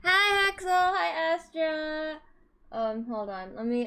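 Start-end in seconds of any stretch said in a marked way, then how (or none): 0.0-2.2 high, loud cutesy hello; cute anime streamer. Acted. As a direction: chirp each name brightly. Greeting viewers by name.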